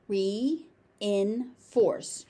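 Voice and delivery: "Reinforce" split as ree, in, force; syllable by syllable. Shown as a demonstration. Teacher breaking the word down for learners, syllable by syllable. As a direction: pause between each syllable of "Reinforce".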